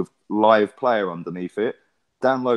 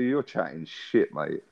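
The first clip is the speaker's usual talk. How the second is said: slow and a bit quieter, drawn out; slower, softer retort; 7 semitones lower, 6 dB quieter, slower than usual. Retold or imitated. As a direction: drag out "chatting shit, mate" slowly. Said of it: "That's exactly what I said".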